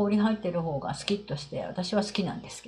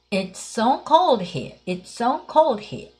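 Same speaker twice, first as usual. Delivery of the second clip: "It's so cold here." said very slowly, word by word; slow model reading; 3 semitones higher, much slower than usual. Shown as a demonstration. Teacher slowly modelling the phrase for the class. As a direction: spell it out slowly for learners to copy. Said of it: "そして三つ目".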